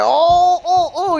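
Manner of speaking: high, loud, laughing little ohs; giddy celebrating oh oh oh; excited oh. Retold or imitated. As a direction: squeal with joy in the car. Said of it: "You know how you be like".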